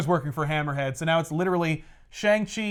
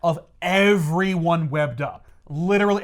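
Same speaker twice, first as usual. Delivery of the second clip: ordinary delivery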